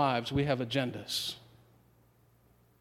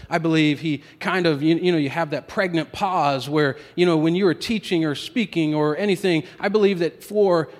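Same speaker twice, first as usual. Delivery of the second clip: preaching at full force; 2 semitones higher, 7 dB louder than usual. Acted. Preacher builds on the pause.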